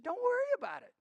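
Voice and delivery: very high, breezy reassurance; high-pitched mock sweetness; imitating new mother. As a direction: wave it off in a high voice. New mother reassures him, retold.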